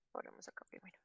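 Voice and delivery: breathy whispered aside to herself. Real, not acted. Teacher whispering to herself while writing on the board. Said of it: "Perdón".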